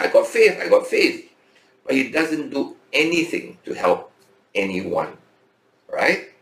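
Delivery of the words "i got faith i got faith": mocking a man who claims faith, high boast; boastful believer. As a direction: imitate a man bragging "I got faith". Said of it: "The man said".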